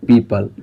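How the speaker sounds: पीपल said slowly and clearly as "pee-pl"; correct British pronunciation. Shown as a demonstration. Teacher to students, modelling पीपल as "pee-pl". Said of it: "क्या बोलते हैं".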